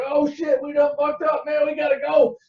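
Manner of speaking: high, loud panic; panicky buddy. Retold or imitated. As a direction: urge the escape in alarm. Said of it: "His buddy's like".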